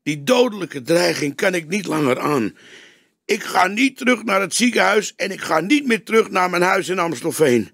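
a hint of a nervous witness; frightened man. A narrator's reading. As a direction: play the threatened man set on fleeing. Frightened man explains his plan.

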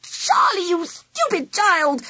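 high-pitched slow shriek of outrage. A narrator's reading. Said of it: "shrieked Auntie Sally".

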